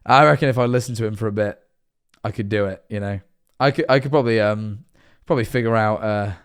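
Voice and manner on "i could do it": usual speaking voice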